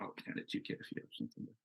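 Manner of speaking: barely voiced, trailing off; whispered handoff. Real, not acted. Panelist passing the question to Kit, whispered. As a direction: drop to a near whisper as you pass it on. Handing over mic, mumbled aside.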